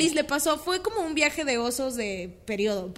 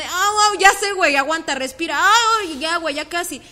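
mock pained coaching; 5 semitones higher, 9 dB louder than usual. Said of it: "estábamos gimiendo de dolor".

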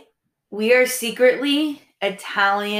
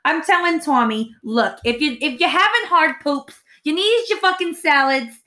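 put-on Queens Italian accent. Mocking a Queens Italian mom to podcast listeners, hammed up. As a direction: ham up a Queens Italian housewife. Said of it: "we talk like this like Italian women".

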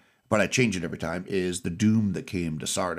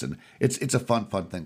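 normal talking voice

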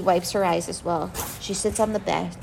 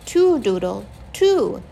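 put-on toddler voice; 5 dB louder, slower than usual. A narrator's reading. Toddler Penny counting.

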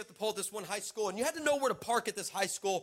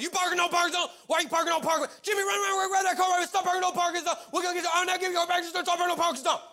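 high, loud, rapid babbling shout; hammed-up screaming tirade, words tumbling together; frantic yelled rant; 10 semitones higher, 6 dB louder, faster than usual. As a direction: rant wildly in a high, pushed voice. Acted frantic rant at car owner.

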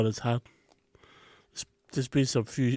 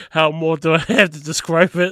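louder exasperated retort, acted out; mock exasperation with raised volume; exasperated reply; 3 semitones higher, 8 dB louder than usual. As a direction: push back at a nosy boss, loudly. Retelling exasperated reply to the boss.

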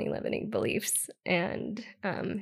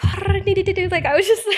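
high, loud, excited burst; bursting with excitement at her new vision; excited rush; 11 semitones higher, 13 dB louder, much faster than usual. Real, not acted. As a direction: blurt it out with rising excitement. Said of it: "it poured out of me".

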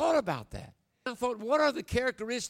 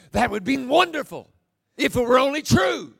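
loud, higher sarcastic retort; mocking woman; 3 semitones higher, 10 dB louder than usual. Facebook stranger replying to preacher, sarcastic. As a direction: sneer like a cynical stranger. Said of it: "she posted back and she said".

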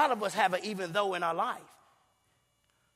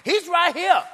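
emphatic proclaim; 8 semitones higher, 7 dB louder, slower than usual. Real, not acted. Preacher exclaiming, repeated line.

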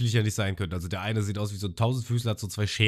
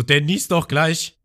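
high, louder, slow alarmed voice; Sanji imitated; 7 semitones higher, 8 dB louder, slower than usual. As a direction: warn of the sneeze in mock alarm. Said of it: "und Sanji so". (hohe, laute, alarmierte Stimme)

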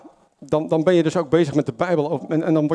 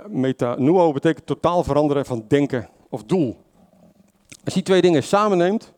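ordinary talk

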